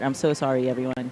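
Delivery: quiet. Real, not acted. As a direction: mutter the apology, embarrassed. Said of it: "poor attempt ... on my part".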